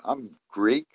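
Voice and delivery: ordinary delivery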